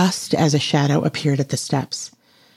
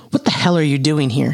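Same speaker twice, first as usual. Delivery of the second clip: ordinary delivery, nothing marked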